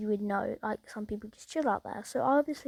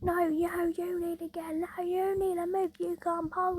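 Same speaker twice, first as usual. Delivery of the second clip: higher mock argument, only half shouted; retold shouting argument; 6 semitones higher than usual. Driver argues with the parked man, higher mock shouting. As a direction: snap back at him in a higher voice. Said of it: "they start having a shouting argument".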